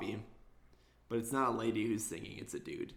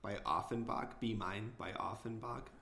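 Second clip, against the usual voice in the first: ordinary delivery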